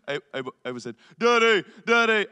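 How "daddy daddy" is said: high, loud imitation of a teasing child; mocking toddler. Toddler son teasing his sleepy dad, imitated mocking call. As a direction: call out like a toddler taunting a parent. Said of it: "he's mocking me".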